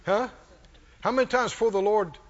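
usual speaking voice

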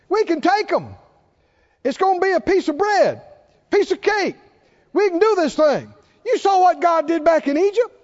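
high, bright rallying plea; eager persuading. Retold or imitated. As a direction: fire them up to believe they can win. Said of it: "tried to convince 'em and said".

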